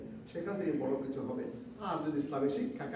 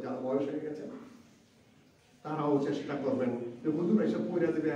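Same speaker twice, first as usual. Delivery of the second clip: ordinary talk, nothing marked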